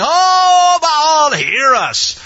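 long, high, loud wail of appeal; calling on Baal. Acted. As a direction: cry out imploringly, drawing out the first word. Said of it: "they called on the name of Baal".

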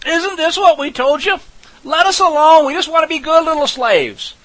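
high, loud, complaining whine; whining Israelites. Acted. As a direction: whine like a crowd of ungrateful ex-slaves. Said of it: "they begin to whine".

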